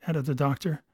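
usual speaking voice

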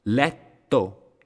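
Letto split by a stop before "to"; exaggerated double-consonant pause. Shown as a demonstration. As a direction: hold the T inside Letto. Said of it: "Noten la, la pausa que hago".

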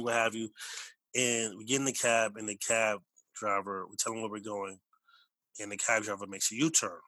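usual speaking voice

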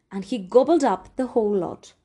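plain reading voice

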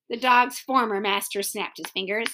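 usual speaking voice

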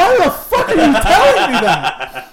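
high, loud, drawn-out disbelief; acted incredulous outburst. Storyteller replaying his reply to bunkmate, incredulous. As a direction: retell it appalled, half laughing.

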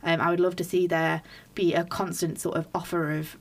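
ordinary talk

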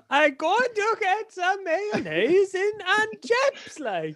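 very high, slow sing-song Welsh; mock Welsh accent. Teasing a mate's attempt at the line, mock Welsh. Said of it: "in Welsh".

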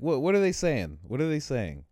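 high, loud mock puzzlement; eager questioning. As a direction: imitate someone pestering for a translation. Imitates him asking about song.

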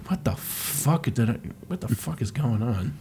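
soft, low-key mock disbelief; quiet bewilderment. Yoshi watches the scene baffled, soft mock bewilderment. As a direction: say it softly, as if utterly baffled. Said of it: "Yoshi's got that perfect look".

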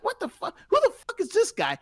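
squeaky high-pitched mock rant; high mock imitation of the caller. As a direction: mimic him in a shrill mocking squeal. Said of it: "He's like".

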